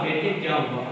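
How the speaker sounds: soft, low, tender asking; loving. Acted. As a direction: gently comfort the crying boy. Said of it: "پیار محبت کے ساتھ کہا".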